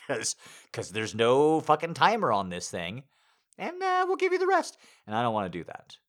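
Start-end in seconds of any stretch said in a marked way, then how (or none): 3.6-4.8 loud, very high squeaky sign-off; body's mock promise. Body's voice finishes its offer.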